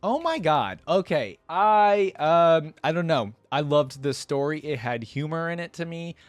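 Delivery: usual speaking voice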